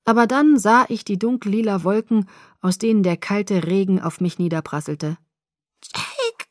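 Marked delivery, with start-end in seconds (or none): 5.8-6.4 high, weak, strangled croak. A narrator's reading. Bella calling her rescuer after nearly drowning, faint croak. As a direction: force the name out of a sore throat. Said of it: "krächzte ich".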